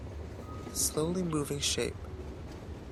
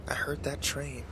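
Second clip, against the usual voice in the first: softer, hushed narration; 7 dB quieter than usual. A narrator's reading. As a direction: let it sink in, softly. Narrator dwelling on the whistle.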